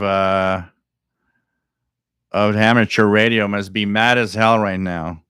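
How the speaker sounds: ordinary talk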